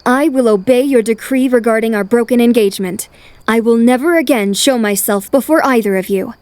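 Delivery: loud, resolute pronouncement; formal declaration. Acted. Lady accepting the prince's decree before everyone, resolute. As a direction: declare it firmly to the whole room.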